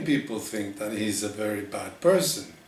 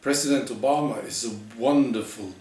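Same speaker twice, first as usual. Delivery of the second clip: normal talking voice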